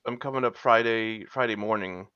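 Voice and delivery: ordinary talk, nothing marked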